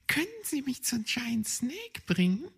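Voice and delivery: high, polite hedgehog lady; old hedgehog lady voice. Acted. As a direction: ask in a high little-lady voice. Frau Igel asks.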